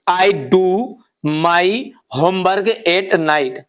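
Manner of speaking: very slow, each word spaced out; measured dictation pace. Shown as a demonstration. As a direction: dictate it for the class to copy. Teacher dictates new sentence.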